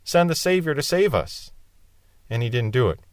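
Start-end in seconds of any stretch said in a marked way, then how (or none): 0.0-1.5 mock desperate cry. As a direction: act out crying to heaven for rescue. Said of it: "and cried out".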